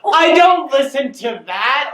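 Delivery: high, slow, loud objection; loud protest. Real, not acted. Student disowns listening to [name], loud high protest. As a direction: protest it at the top of your pitch.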